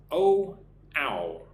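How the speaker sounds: bare vowels "Oh" and "ow", drawn out separately; vowel demo. Shown as a demonstration. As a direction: isolate "Oh" loudly, then glide through "ow". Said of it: "pronounce no and now".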